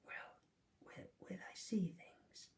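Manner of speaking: faint, halting breathy whisper; hurt boy barely asking; weak whisper. Acted. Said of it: "he whispered".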